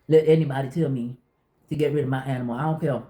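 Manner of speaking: usual speaking voice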